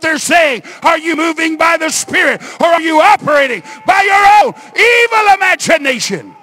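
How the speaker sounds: high, shouted preacher's challenge; full-force preaching. Real, not acted. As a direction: thunder it out to the congregation.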